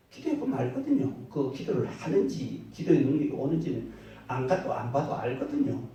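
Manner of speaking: loud, rising sermon delivery; preaching. Acted. Pastor preaching to the congregation, in a loud sermon voice.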